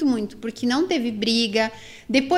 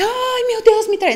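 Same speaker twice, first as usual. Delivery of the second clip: higher, louder mock gasp of a wife playing dumb; mock naive wife; 9 semitones higher, 3 dB louder, slower than usual. Mocking women who claim they didn't know about the cheating. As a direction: ham up a wife pretending she never knew. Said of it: "mulher que fala que não sabe".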